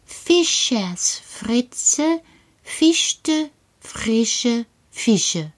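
very slow, every syllable crisp; over-articulate "Fischers Fritze" slowly; very clear speech. Shown as a demonstration. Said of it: "sehr deutlich zu sprechen".

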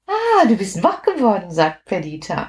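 ordinary delivery, nothing marked

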